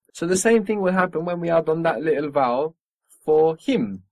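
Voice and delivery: ordinary talk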